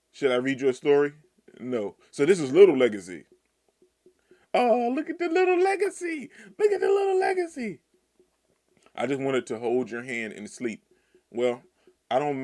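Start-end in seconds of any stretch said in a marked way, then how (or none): 4.5-7.8 cooing. Real, not acted. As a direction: gush over the cute little one. Cooing over child character.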